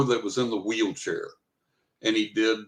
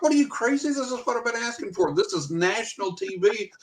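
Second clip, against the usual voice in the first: high, indignant protest; exasperated retold argument; arguing; 10 semitones higher than usual. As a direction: argue your case in raised-pitch disbelief. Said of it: "And I'm arguing with them".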